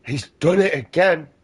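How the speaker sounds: high, loud, scandalized mock; mock offended gasp. Parodying an offended person, high loud mock gasp. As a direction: gasp then cry foul, hammed-up.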